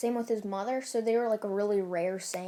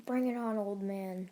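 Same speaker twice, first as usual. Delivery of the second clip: usual speaking voice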